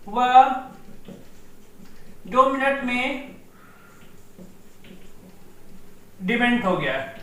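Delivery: slow, broken into chunks with long pauses; slow dictation. Shown as a demonstration. Teacher dictating the mnemonic to students, slow with long pauses. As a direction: dictate it piece by piece so students can write. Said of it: "वह दो minute में demat हो गया (repeated for dictation)".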